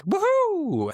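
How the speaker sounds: high-pitched whoop of joy, replayed in a story; retold joyful scream. Quoting his own old sales call, a high excited whoop. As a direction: shriek it like a delighted salesman. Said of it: "you screamed like".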